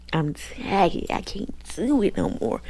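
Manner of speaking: acted exasperation, pitch climbing; fed-up outburst. Storyteller voices Catherine telling him she's done, exasperated. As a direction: play it worn out and at the end of your rope.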